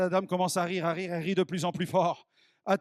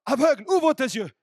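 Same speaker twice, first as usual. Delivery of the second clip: loud, high proclamation; booming command to the blind; loud divine command; 6 semitones higher, 8 dB louder than usual. Acted. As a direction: call it out over the crowd. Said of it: "et j'entends".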